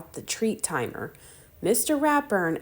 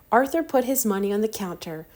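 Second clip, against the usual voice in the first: ordinary delivery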